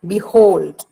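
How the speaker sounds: "Behold" with "be" kept light and "hold" carrying the weight; stress on HOLD. Shown as a demonstration. Teacher repeating the example for students, "Behold" stressed on "hold". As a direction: lean on the second syllable of "Behold". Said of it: "Hold is heard much".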